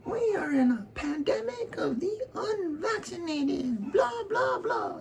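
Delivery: shrill falsetto mock of the governor. Mocking the Guam governor in a squeaky falsetto. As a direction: squeak it out like a whiny official. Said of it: "the governor on Guam comes out".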